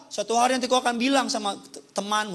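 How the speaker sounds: usual speaking voice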